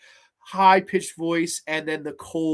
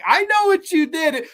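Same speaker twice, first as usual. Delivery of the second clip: high, loud hammed-up accusation; mock accusation; 12 semitones higher, 6 dB louder than usual. Tomie looking at her killer, mock accusing. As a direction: call the killer out, pitched up and loud.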